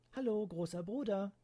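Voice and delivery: put-on high girl's voice greeting her brother; bright little-sister hello; high child greeting; cheerful little sister.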